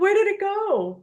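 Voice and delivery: high, drawn-out puzzled question; mock bewilderment. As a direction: wonder aloud in exaggerated puzzlement. Acts puzzlement at vanished accent.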